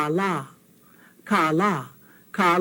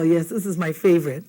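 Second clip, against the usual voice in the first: ordinary talk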